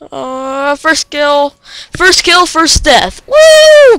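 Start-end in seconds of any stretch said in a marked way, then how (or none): 3.3-4.0 very high, loud cheer; excited whoop. Real, not acted. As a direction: whoop with delight. Cheering first kill.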